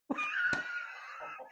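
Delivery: very high squealed laugh; real high-pitched giggle fit; cracking up. Real, not acted. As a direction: wheeze with helpless laughter. Laughs at dad's joke.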